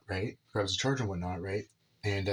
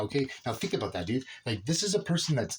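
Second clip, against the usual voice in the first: ordinary talk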